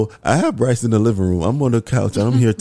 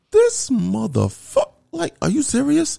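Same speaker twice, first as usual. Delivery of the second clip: much higher, slow disbelieving huff; husband watching his wife walk off, hammed-up indignation, higher; mock indignant. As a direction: play it up as offended and slow. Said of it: "Yo, I was tight".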